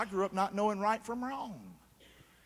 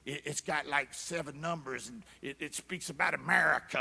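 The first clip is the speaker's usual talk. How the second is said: kooky believer; 5 semitones lower, 4 dB quieter than usual. Retold or imitated. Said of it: "all this kooky stuff".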